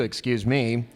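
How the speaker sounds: normal talking voice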